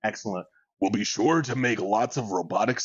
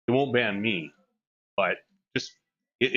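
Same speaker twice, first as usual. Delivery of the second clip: usual speaking voice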